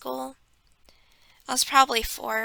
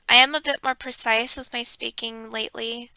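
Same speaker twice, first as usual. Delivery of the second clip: normal talking voice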